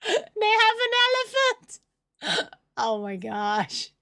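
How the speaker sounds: high, slow, half-crying; hammed-up wailing panic. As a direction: wail like the world ended. Panic over discovery, crying.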